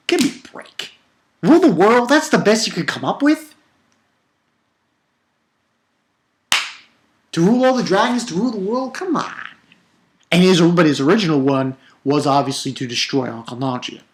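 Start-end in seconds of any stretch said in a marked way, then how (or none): 1.4-9.3 high-pitched, exasperated disbelief; incredulous scoffing. Real, not acted. Reviewer ranting at a cliche villain goal, incredulous scoffing.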